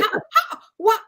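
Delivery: high, stammered disbelief; high-pitched mock bewilderment; stunned. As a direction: imitate stunned onlookers, with laughter in it. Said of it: "they were stunned".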